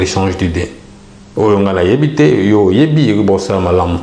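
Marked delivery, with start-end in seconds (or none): none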